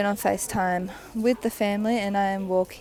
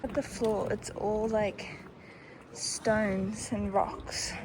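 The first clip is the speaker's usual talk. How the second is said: normal talking voice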